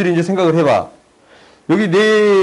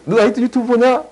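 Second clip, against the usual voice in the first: nagging mom; 10 semitones higher than usual. Retold or imitated. Tutor imitating a mom catching her kid gaming, high nagging voice. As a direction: play the exasperated mother catching him.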